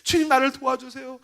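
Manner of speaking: high, hushed mock-weeping plea; acted tearful prayer. Young preacher crying to God for help, tearful reenactment. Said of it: "매일 한 시간씩 울었어요".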